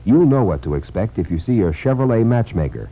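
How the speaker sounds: ordinary delivery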